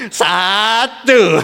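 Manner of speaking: "Satu" said with a laugh. Shown as a demonstration. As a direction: giggle through "Satu". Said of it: "Dilus-lus". ("Satu" sambil tertawa)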